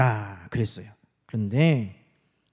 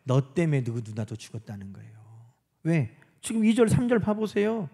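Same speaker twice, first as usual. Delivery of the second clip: ordinary talk, nothing marked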